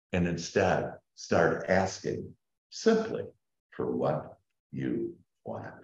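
ordinary delivery

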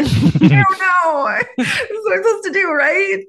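high, giggly, unsure question; laughing. Retold or imitated. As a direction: laugh through the words. Retelling her own unsure reply.

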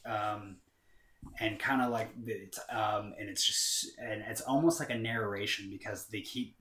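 ordinary delivery

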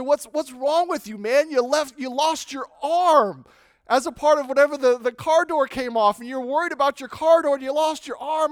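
high, loud disbelieving cop; incredulous police officer. Retold or imitated. Officer scolds the driver over his lost arm, loud and incredulous. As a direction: scold him like a stunned officer. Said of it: "The police officer".

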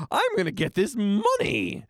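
slow, lightly voiced Homer impression; a hint of Homer, slowed down. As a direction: drawl it like a dim cartoon dad. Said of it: "That's my Homer voice".

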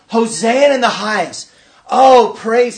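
loud, jubilant mock acclamation; acclaiming crowd. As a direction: call out praise like a cheering crowd. Acts the Palm Sunday crowd.

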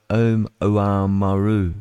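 slow, syllable by syllable: Om-, Oamaru; careful Oamaru. Shown as a demonstration. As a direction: sound out Oamaru carefully, slowly. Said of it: "I don't know why we say it like that".

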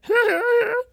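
squeaky in-character laugh; high cartoon laugh. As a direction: let out a very high cartoon giggle. Laugh after Cleveland impression.

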